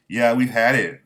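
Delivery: loud defiant outburst; villagers fed up. Retold or imitated. Villagers declaring they've had enough.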